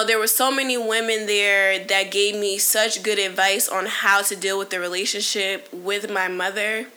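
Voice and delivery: normal talking voice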